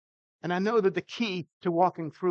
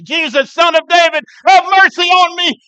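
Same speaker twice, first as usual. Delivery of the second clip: desperate shout; 11 semitones higher, 15 dB louder than usual. Retold or imitated. Blind beggar shouting for Jesus over the crowd, desperate cry. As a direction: cry out at full voice, much higher. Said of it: "he gets louder and louder".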